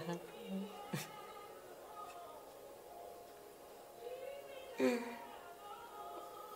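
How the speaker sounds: long, airless high sob; the crying takes his breath; breathless sob. Real, not acted. Grieving friend sobs without voice. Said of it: "I'm glad I'm crying".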